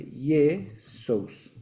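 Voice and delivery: Jesús said slowly as ye-SOUS, with a y; Greek pronunciation. Shown as a demonstration. Teacher showing the Greek form, Jesús as ye-SOUS. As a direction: draw Jesús out in two parts, ye-sous. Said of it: "En griego se escribe".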